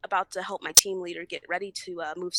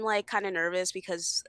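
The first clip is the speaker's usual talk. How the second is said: ordinary talk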